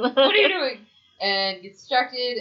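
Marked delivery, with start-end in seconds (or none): 0.0-0.8 mock yell. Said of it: "he's like".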